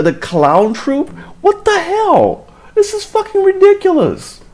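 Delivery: high-pitched incredulous ranting; real outrage. Real, not acted. Commentator fed up with the police, outraged ranting. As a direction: rant in disbelief, stretching words out high. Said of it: "this is making me so mad".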